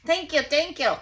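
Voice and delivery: high, loud, relieved thank yous; excited thanks. Real, not acted. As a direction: burst out in grateful relief. Object finally comes loose.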